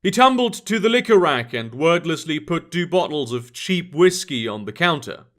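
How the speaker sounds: usual speaking voice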